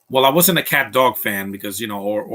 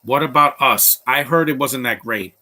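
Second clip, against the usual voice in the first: ordinary delivery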